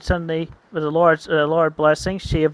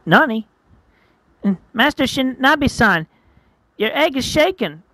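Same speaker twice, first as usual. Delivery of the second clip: high-pitched anime student voice; alarmed student; 7 semitones higher, 3 dB louder than usual. Acted. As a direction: warn the master in a high voice. Student alarmed at shaking egg.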